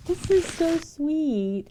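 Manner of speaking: high, slow, gushing and touched. Real, not acted. Streamer gushing over viewers' sweet notes, slow and high. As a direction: stretch it out, melting over a gift.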